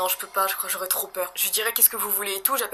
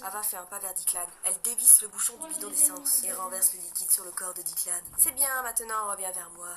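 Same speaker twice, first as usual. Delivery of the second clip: hushed narration; 2 semitones lower, 13 dB quieter than usual. Acted. Reading the climax of the horror story, hushed. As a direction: draw listeners in, hushed.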